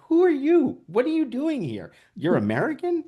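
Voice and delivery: high, amazed imitation of puzzled locals; astonished locals. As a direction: squeak it out in total disbelief. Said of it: "And they're like".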